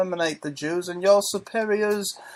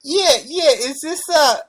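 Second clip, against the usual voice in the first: Mike Tyson; 3 semitones higher than usual. Acted. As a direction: put on a high, light boxer's voice. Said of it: "this is Mike Tyson".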